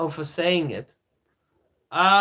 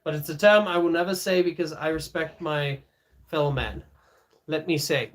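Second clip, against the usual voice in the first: normal talking voice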